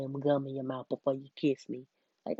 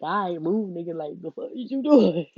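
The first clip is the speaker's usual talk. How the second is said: louder, exasperated brush-off; annoyed retold shout; 9 dB louder than usual. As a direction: push him off with your voice. Retelling pushing him away.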